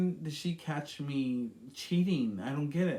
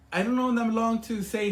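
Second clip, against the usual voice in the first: raised, louder upset voice; louder, higher and upset; upset outburst; 8 semitones higher, 7 dB louder than usual. Real, not acted. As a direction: blurt it out in distress.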